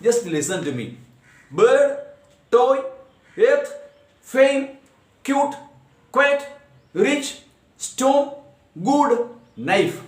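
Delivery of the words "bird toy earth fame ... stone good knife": high, isolated words, each in one beat; each word together. Shown as a demonstration. Teacher reading monosyllabic examples, each word together. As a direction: read out a word list, one undivided beat apiece. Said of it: "I pronounce all these words together".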